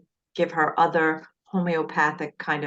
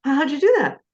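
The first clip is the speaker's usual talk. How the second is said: higher, louder mock amazement; amazed doctor; 7 semitones higher, 5 dB louder, faster than usual. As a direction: play the baffled doctor, pitch up and louder. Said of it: "And he goes".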